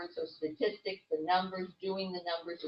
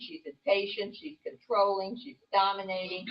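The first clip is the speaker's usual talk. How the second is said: ordinary talk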